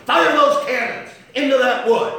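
a pulpit shout topping the first. Acted. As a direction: slam the repeat harder. Repeated even louder.

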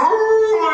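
long, high, loud howl; bay like a dog at the moon; imitated dog howl. Preacher showing how a dog howls, loud imitated howl. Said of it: "the dog would bay at the moon".

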